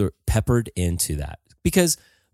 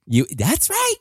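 high mimicking voice; 13 semitones higher, slower than usual. Mimicking Scala's high reply.

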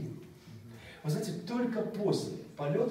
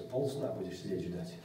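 ordinary delivery

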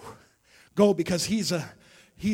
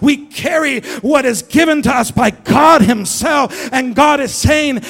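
louder, higher, shouted preaching rhythm; full-force preaching; 5 semitones higher, 12 dB louder than usual. Acted. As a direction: preach it hard to the room.

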